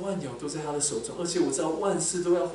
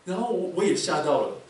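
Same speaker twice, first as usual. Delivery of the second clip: normal talking voice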